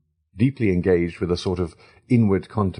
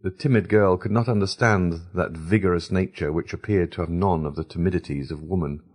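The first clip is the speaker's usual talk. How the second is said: plain reading voice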